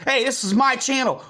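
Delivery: usual speaking voice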